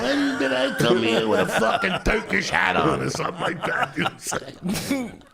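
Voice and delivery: high, loud, laughing indignation; ranting about the curry, half laughing; incredulous laughing complaint. Real, not acted.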